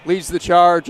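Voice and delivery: usual speaking voice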